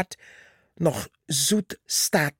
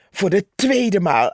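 ordinary delivery

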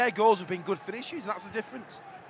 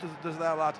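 ordinary talk, nothing marked

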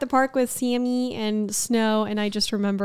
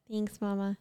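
usual speaking voice

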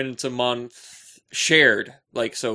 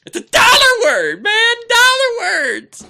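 high, loud comic protest, slower on the last words; a big, high, hammed-up shout; mock-indignant shout; 12 dB louder than usual. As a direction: yell it up high like a wounded showman.

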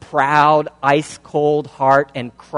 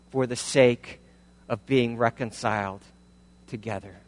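humble concession; 3 semitones lower, 5 dB quieter than usual. Acted. One spouse ending the quarrel, humbly conceding. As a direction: lay down your pride gently.

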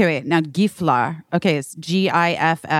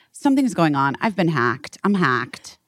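normal talking voice

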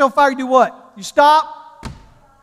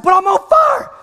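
high-pitched mock desperation; protesting yell; 8 semitones higher, 4 dB louder than usual. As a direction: wail it in hammed-up protest. Protests to wife in acted quarrel.

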